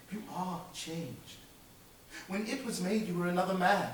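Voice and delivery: a hint of a grieving young girl; young woman quietly ending the engagement; lightly voiced sad young woman. Belle's voice in the scene.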